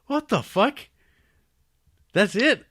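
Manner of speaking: mock outraged viewer; stunned disbelief. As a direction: shout your shock at the sudden ending. Reacting to the abrupt ending.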